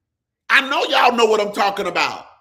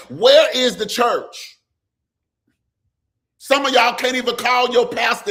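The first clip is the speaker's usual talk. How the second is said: preaching at full force; 3 semitones higher than usual. Acted. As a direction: preach it hard to the congregation. Preaching against the church.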